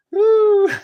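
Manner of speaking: very high, very loud whoop; triumphant whoop. Real, not acted. Caster celebrating his triple pour for the stream, shrieking whoop. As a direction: yell out in victory.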